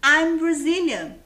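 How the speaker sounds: slow loud teacher model. Shown as a demonstration. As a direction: model "I'm Brazilian" for the class to repeat. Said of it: "Por exemplo".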